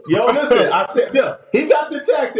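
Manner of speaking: laughing, loud and excited. Real, not acted. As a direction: shout it through laughter.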